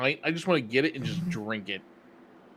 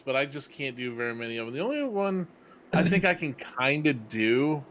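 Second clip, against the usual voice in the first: ordinary talk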